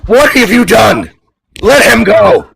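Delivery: high, loud panicked yell; frantic shout. Acted. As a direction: scream at the villain to let him go. Host panics at captured cohost.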